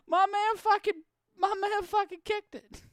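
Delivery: high, hyped-up disbelief; can't get the words out, still amazed; incredulous excitement. Real, not acted. Stunned reaction to a kicked interception.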